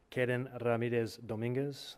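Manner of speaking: the name said with a light Spanish accent; a hint of Spanish pronunciation on the name. Shown as a demonstration. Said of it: "That was my best Spanish accent".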